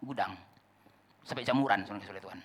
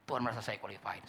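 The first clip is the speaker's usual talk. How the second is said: much softer, lower echo; hushed afterthought; quiet repeat; 5 semitones lower, 9 dB quieter than usual. Acted. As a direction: let it sink in softly.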